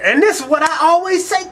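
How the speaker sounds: usual speaking voice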